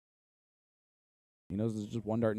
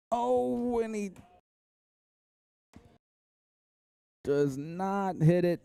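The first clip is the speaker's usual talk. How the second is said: oh jumps way higher, then a slow let-down; excited call; 8 semitones higher, much slower than usual. Real, not acted. Commentator reacting high and slow to the missed dart.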